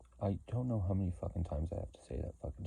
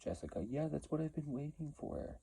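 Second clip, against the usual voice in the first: higher, lightly sarcastic imitation; mock condescending voice; 9 semitones higher, 3 dB quieter than usual. Imitating the watchers talking down to Jessica, a light sarcastic voice. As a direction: play them patronising her. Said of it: "It's like".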